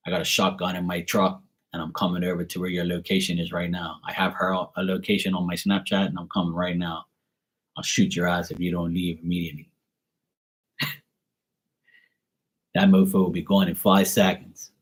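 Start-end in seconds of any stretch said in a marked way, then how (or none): 0.1-9.7 lower, softer menacing dad threat; low, hushed threat as a girl's protective father. Pretend. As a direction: play the shotgun-toting dad, low and quiet. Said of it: "I'll be like".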